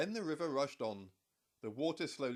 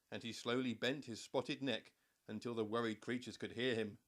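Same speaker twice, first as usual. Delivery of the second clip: plain reading voice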